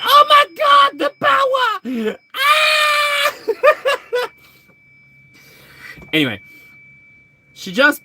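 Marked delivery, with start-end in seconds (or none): 0.0-3.3 high, loud overwhelmed scream; shocked scream. Retold or imitated. Jean screaming as she's overwhelmed by power. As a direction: cry out as the power floods in. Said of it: "She's like".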